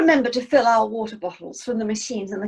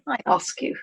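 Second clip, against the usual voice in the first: ordinary delivery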